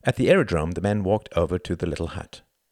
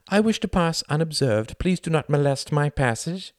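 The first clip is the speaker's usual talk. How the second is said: high-pitched joke voice; mocking glider voice; 10 semitones higher than usual. A narrator's reading. Pilot voicing the glider for laughs, mocking. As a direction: speak up high as the timid glider.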